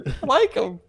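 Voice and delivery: very high, squealed surrender; hammed-up, high-pitched pleading; mock cowed protest. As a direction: give in like a yelled-at kid. Plays the yelled-at kid giving in.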